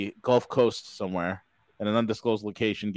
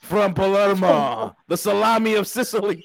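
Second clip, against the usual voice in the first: high, slow hammed-up announcer; hype announcer voice; 9 semitones higher, 5 dB louder than usual. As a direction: ham up the big intro. Hyped-up guest intro, laughing.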